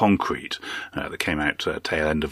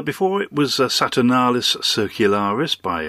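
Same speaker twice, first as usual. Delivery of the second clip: usual speaking voice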